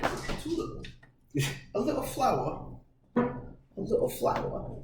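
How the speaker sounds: put-on dainty, high English accent; tiny high mock-English voice. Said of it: "Is that your English accent?".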